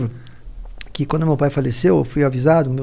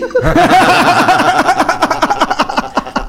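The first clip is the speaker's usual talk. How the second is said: high, loud burst of real laughter; 12 semitones higher, 7 dB louder than usual. Real, not acted. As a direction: crack up laughing mid-story. Laughs telling the funeral story.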